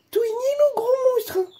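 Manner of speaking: high whining pet voice; whiny pet voice. Pretend. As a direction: whine like the pet.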